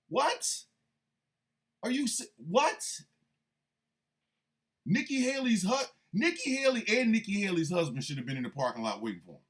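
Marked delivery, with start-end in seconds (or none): none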